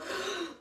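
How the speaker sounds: a quick high sharp intake of breath; sharp gasp. Shown as a demonstration. Guide demonstrating a startled in-breath, sharp gasp. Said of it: "And then you go".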